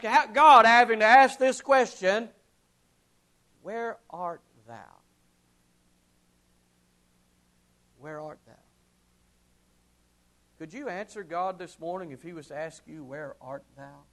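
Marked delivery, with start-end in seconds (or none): 3.6-8.5 soft, low, near-whispered asking; hushed divine question. Pretend. God asking the hearer quietly, hushed. 13.0-14.0 soft, low, near-whispered asking; hushed divine question. Pretend. God asking the hearer quietly, hushed.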